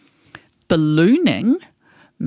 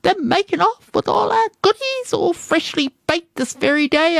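very high-pitched panicked cry for help; distressed cry; 13 semitones higher than usual. Acted. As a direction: sound the alarm as a robbed baker, much higher. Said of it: "A baker cried in great distress".

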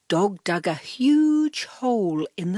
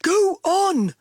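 loud eager dog bark; 11 semitones higher, 6 dB louder than usual. A narrator's reading. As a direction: blurt it out like an excited dog. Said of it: "Dog barked".

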